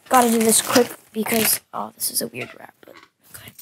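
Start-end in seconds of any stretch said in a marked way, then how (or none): 1.7-3.5 breathy whisper to the camera. Real, not acted. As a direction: whisper it quickly and secretly. Secretly tampering with the lunch.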